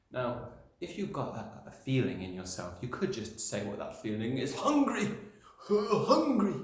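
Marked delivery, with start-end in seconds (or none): 4.5-6.6 loud high shout of one word. Shown as a demonstration. Teacher showing a bare one-word shout. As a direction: bellow it like a hungry kid. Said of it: "just shouting the word hungry".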